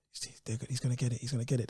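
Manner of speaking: soft, hushed murmur; hushed confidence. Retold or imitated. Voicing his missus at the awards, hushed murmur. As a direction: lean in and murmur it to your partner. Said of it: "she was like".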